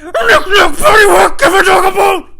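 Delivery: high, full-force mock battle chant; screamed nursery rhyme. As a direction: scream the rhyme like a war cry. Imagined song kicks in during fight.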